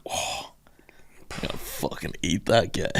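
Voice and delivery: low greedy mutter, almost whispered; hungry cannibal. Pretend. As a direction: savour the thought of eating him. Imagined cannibal eyeing him.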